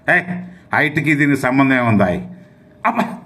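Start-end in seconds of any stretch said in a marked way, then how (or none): 2.9-3.2 yelp of pain. Acted. Cry after being hit.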